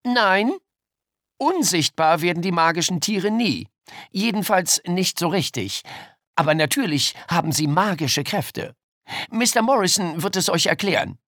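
lightly voiced teacher addressing pupils. A narrator's reading. Teacher answers the class.

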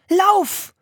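put-on very high girl's shout; shrieked one-word command; urgent scream. A narrator's reading. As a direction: yell for him to run. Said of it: "schrie Anne".

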